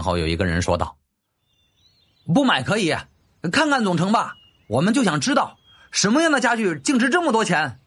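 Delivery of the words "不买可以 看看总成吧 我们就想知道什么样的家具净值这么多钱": a curious onlooker shouting up from the crowd; man in the crowd. Acted. (人群中一人高声喊道)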